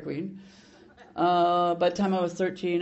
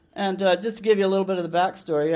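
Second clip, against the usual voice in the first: normal talking voice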